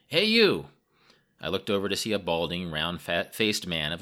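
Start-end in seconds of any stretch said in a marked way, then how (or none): 0.1-0.7 high, loud hail in a foreigner's English; loud call. A narrator's reading. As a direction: call out boldly across the carriage. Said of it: "he spoke rather loudly".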